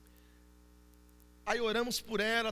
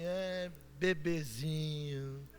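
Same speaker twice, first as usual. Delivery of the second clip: mock baby talk; 5 semitones lower, 9 dB quieter, much slower than usual. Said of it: "Deu vontade de olhar pra ela assim".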